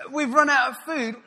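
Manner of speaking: high, loud hammed-up butler; strange butler accent. Butler Carson repeating the news, hammed-up high and loud. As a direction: squeak it out like an odd servant. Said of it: "he's got a strange accent".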